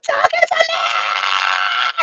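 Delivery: long drawn-out yell, pitch dropped low; retold shout at Yehuda. Snapping at Yehuda for telling her to calm down, in a drawn-out yell. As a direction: cry it out, fed up and slow. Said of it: "I'm screaming and hollering".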